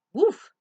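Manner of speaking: high loud woof; mock woof. Dog answering Duck with a loud woof. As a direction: bark like a dog.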